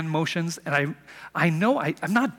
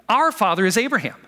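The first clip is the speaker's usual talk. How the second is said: louder, higher protest; indignant retort; 7 semitones higher, 6 dB louder than usual. Retold or imitated. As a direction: snap back proudly, a touch louder. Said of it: "their response is immediate".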